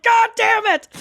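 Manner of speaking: high, loud cry of frustration; hammed-up howl of defeat; mock exasperated yell. George foiled again, retold.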